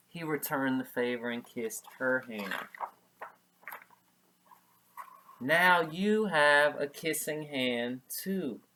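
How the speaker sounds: storybook read-aloud; story narration. A narrator's reading. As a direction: read the tender scene to kids.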